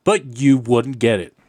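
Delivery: normal talking voice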